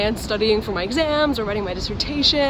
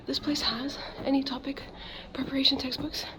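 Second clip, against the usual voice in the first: whispering; 3 semitones higher, 11 dB quieter than usual. Real, not acted. Whispering in the shop.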